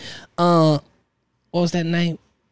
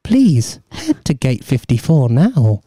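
slow, low boarding call voice; airport announcement; 7 semitones lower, slower than usual. Pretend. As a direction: announce the gate like an airport tannoy.

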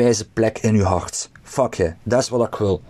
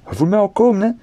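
much higher, playful and teasing; high teasing repeat; 9 semitones higher, faster than usual. Shown as a demonstration. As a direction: tease them with a rising, knowing voice. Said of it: "teasing repeat".

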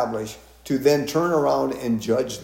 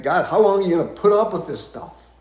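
exasperated plea to God; 6 semitones higher, 5 dB louder than usual. Retold or imitated. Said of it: "I think".

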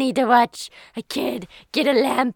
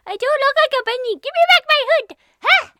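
much higher, fast funny voice; 10 semitones higher, 4 dB louder, much faster than usual. Acted. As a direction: rattle it off in a squeaky voice. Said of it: "you have a funny voice".

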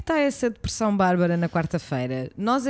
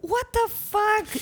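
drawn-out disbelief; 11 semitones higher, much slower than usual. Acted. Reacting to the cold room.

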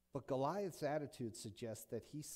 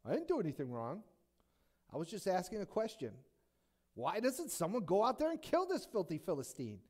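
put-on rising, louder protest of a kid brother; whiny little brother; 5 semitones higher, 5 dB louder than usual. Little David protesting to his big brother, rising and louder. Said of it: "the classic little brother response".

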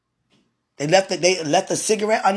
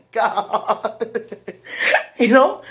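normal talking voice

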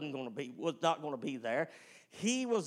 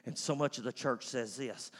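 normal talking voice

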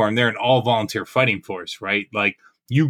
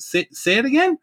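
high-pitched mock-incredulous question; daring him to repeat it, voice shooting up; retold challenge; 14 semitones higher than usual. Soldier answering the sergeant's trash talk, challenging.

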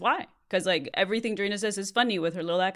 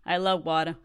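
lightly put-on Boston accent. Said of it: "We love a Boston girl".

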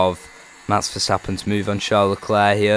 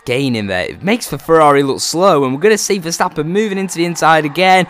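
rising louder race call; excited commentary; 8 semitones higher, 6 dB louder than usual. Acted. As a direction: build the excitement on the move.

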